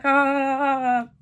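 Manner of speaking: imitated giddy groom laugh; mock a groom's delighted, oblivious chuckle. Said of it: "sitting there all giddy".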